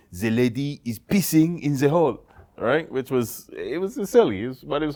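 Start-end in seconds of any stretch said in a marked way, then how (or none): 0.1-2.2 a lightly done bad French accent; slow, botched French accent. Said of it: "the worst French accent".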